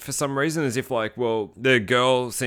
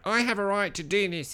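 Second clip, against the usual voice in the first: higher-pitched, entitled nerd voice; mock a self-righteous nerd demanding his rights; imitating a whiny entitled guy, pitched up; nerdy male Karen; 9 semitones higher than usual. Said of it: "he's got this nerdy voice".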